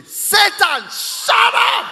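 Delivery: very loud, high-pitched command; explosive preacher's shout; demonstrated shouted rebuke. Shown as a demonstration. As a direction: show them how to yell the devil away. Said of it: "You have to learn how to say".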